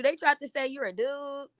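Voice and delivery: usual speaking voice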